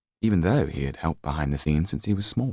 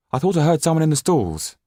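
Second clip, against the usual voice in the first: put-on higher, louder boy's voice; 10 semitones higher, 6 dB louder, faster than usual. A narrator's reading. Yann telling the caretaker about the noise, high and louder. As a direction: tell him you heard someone out there.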